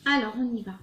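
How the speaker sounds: usual speaking voice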